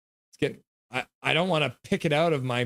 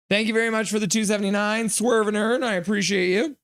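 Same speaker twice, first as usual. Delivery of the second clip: ordinary talk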